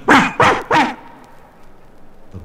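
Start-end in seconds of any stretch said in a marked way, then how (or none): 0.1-1.0 high barking ruffs; mock dog bark. Mother cat barking at bulldog, high mock ruffs. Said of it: "looked at the bulldog and went".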